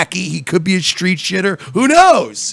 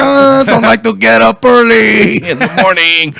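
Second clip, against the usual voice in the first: mock Mexican accent; 5 semitones higher, 7 dB louder than usual. Mock stereotype voice.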